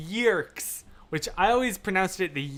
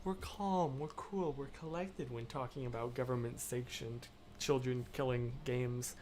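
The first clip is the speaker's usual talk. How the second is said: much softer, deliberately composed voice; calm, brought down; 3 semitones lower, 10 dB quieter than usual. Real, not acted. Streamer stops herself yelling, calm and quiet. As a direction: rein it in, hushed and collected. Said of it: "I need to bring it down".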